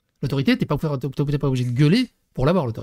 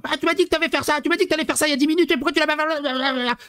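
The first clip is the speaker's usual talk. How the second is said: much higher mock nagging voice; mocking wife's nagging; 9 semitones higher, faster than usual. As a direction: imitate the wife nagging her daughter, pitched up. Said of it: "quand ça commence à s'élever". (imitation de la femme qui houspille sa fille, voix aiguë)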